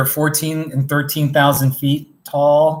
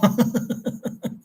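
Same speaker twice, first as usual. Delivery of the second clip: soft, high breathy laughter; embarrassed giggle; 8 semitones higher, 4 dB quieter than usual. Real, not acted. Streamer after attempting British accent, giggling. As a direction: giggle at your own bad accent.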